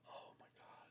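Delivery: breathy whispered oh my God; whispered dismay. Retold or imitated. Husband hearing the necklace is gone, whispering in shock. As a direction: let it out as a stunned whisper.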